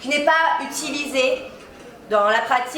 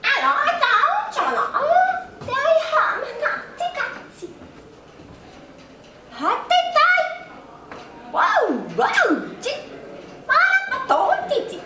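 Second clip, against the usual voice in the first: much higher laughing alien babble; martian with English accent; 10 semitones higher than usual. Pretend. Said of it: "un accent anglais en parlant en martien". (martien avec un accent anglais, en riant)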